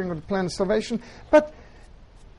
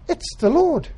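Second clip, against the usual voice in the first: higher, louder cry of recognition; excited recognition; 7 semitones higher, 5 dB louder, slower than usual. Acted. John calling out to the others in the boat, excited. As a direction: call it out as you spot him on shore.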